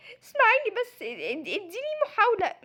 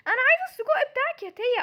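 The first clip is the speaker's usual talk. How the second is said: put-on louder, higher childish demand; whiny demand; 5 semitones higher, 5 dB louder than usual. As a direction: insist like a spoiled kid. Sister demands the sausage.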